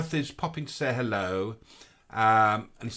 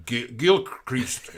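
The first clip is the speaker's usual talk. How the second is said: put-on Southern Irish accent; 3 dB louder, much slower than usual. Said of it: "Gotta do it, Southern Irish".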